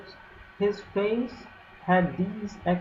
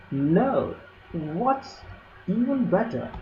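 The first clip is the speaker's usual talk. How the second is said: usual speaking voice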